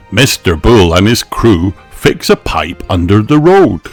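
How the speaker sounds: ordinary delivery, nothing marked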